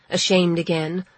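usual speaking voice

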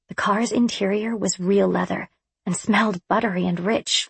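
plain reading voice

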